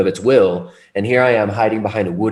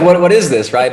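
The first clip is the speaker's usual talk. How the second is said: laughing; 8 semitones higher, 7 dB louder than usual. Real, not acted. Laughing at alien joke.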